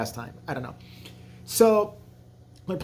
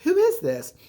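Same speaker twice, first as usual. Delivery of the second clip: much higher, alarmed question; mock scared high-pitched demand; frightened girl; 14 semitones higher, 4 dB louder than usual. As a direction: snap it out startled on the phone. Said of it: "she's like".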